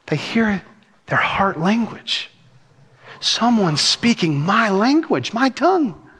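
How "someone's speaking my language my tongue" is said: high, slow, rising amazement; amazed delight. Acted. Visitor hears their heart language abroad, acted amazement. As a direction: voice a listener's astonished recognition.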